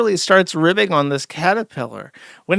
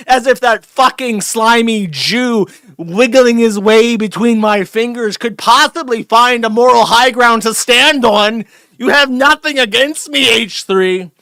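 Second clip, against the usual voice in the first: louder and higher sneering tirade; mock gloating rant; 6 semitones higher, 5 dB louder than usual. Imitating the streamer ranting at H3, mock venomous. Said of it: "He went from".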